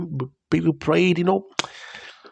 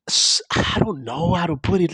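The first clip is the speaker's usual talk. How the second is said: choked up; 3 semitones higher than usual. Real, not acted. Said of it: "it's making me emotional".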